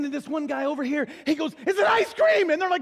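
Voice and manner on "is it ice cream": high shouted guess; hammed-up childlike excitement; overeager angel. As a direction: blurt out a hopeful guess at full volume. Keen angel guesses eagerly.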